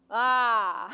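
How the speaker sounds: shrill mocking stage laugh. Villain laugh in monologue.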